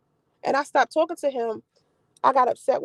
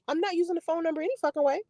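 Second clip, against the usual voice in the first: ordinary talk, nothing marked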